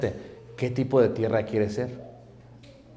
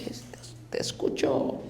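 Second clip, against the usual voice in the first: absent husband, whispered and much softer; distracted whisper; 11 dB quieter, slower than usual. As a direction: mumble it without really listening. Distracted husband answering wife. (esposo distraído susurrando, sin poner atención)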